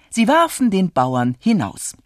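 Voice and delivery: plain reading voice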